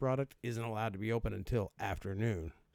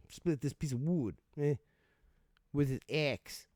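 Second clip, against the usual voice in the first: higher put-on Boston woodcutter; mock Boston accent; 4 semitones higher than usual. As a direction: ham up a Boston accent in short bursts. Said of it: "they wouldn't be talking like that in Boston".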